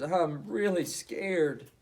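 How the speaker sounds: slow, tearful little voice; almost crying, drawn out; near tears. Acted. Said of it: "on the brink of tears".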